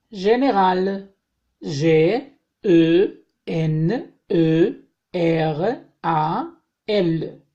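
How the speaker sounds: slow, letter-by-letter French spelling; spelling aloud. Shown as a demonstration. Teacher spelling French words for students, slow and clear. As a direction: spell each word out slowly for the class.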